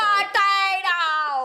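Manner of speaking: imitating a stuffed-up "Potato", drawn out; mock blocked-up voice. Mimics her stuffed-up potatoes.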